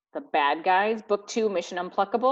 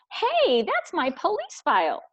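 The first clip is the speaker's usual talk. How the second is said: very high, loud startled hey; indignant surprise; 12 semitones higher, 3 dB louder than usual. Acted. Snake shocked to see his police file, high indignant. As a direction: sound caught off guard.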